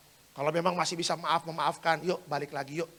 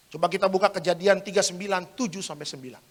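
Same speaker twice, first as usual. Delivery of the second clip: ordinary talk, nothing marked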